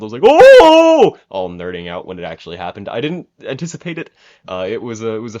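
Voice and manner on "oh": loud, high-pitched "oh!"; excited yell. Retold or imitated. Fan reliving the surprise title finish, excited yell. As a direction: holler a long, high whoop. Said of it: "I, like, audibly yelled".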